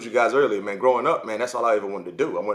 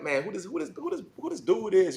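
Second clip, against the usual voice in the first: put-on curious local kids; 6 semitones higher, 5 dB quieter than usual. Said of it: "So it is like".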